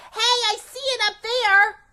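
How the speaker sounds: high, loud and thrilled; a kid spotting her favorite thing; excited discovery. Acted. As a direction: spot the treasure and call it out. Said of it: "I said".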